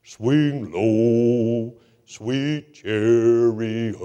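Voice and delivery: a hint of a deep, slow bass singer. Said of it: "he always talked like this".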